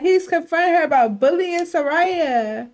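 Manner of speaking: ordinary talk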